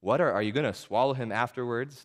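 ordinary delivery

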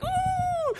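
high squeal of delight; excited girlish squeal. Retold or imitated. Gabriel squealing to God with excitement. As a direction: squeal like an excited little girl. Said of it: "like little girls when they get excited".

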